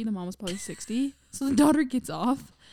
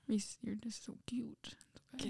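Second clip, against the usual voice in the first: hushed, tender whisper; 14 dB quieter than usual. Real, not acted. Whispering to baby Reese.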